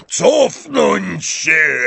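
high, very drawn-out shout; shouted order. A narrator's reading. Hagrid clears the group around Katy, shouting. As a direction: order everyone back in a big voice. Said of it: "krzyknął Hagrid".

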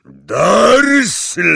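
loud, high roaring name; thundering shout. Acted. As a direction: bellow the name. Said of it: "zagrzmiał".

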